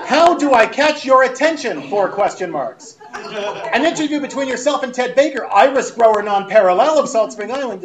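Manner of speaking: loud, high, hammed-up exasperation; yelling email writer. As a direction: act out an email shouted for attention. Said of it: "she's clearly yelling".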